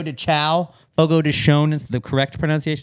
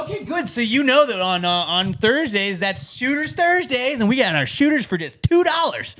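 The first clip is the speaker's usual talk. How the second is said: high-pitched mock waiter pitching specials; imitating an eager waiter; restaurant server spiel; 7 semitones higher than usual. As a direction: sell the specials in a put-on server voice. Continues imitating server's scripted pitch.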